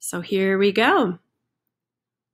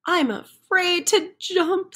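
higher timid chicken voice; a nervous chicken balking at the jump; scared chicken; 9 semitones higher than usual. Acted.

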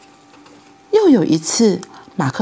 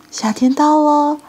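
ordinary delivery, nothing marked

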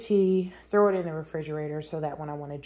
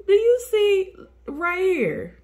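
much higher and louder, slow and stretched; excited pointing out; 14 semitones higher, 11 dB louder than usual. Real, not acted. Showing viewers her dog by the pool, excited. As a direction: call their eyes to the spot.

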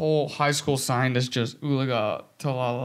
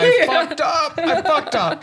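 high, loud mock panicked wail, laughing; 11 semitones higher, 6 dB louder, slower than usual. Boyfriend imitating his panicked self when she cried. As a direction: play his own panic, cracking up. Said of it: "And I go like this".

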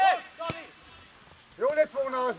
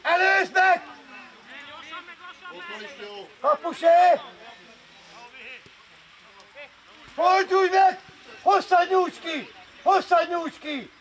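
shouted coaching. Real, not acted. Coach to players during the match, shouting. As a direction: call it out across the pitch.

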